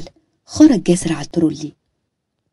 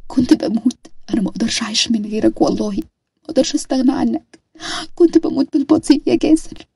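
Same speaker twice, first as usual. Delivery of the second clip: a frightened wife who can't stop picturing him dying; distraught young wife; 6 semitones higher than usual. Acted. Nada tells Jaser her fear. (زوجة مذعورة بصوت عالٍ ومرتفع على وشك البكاء)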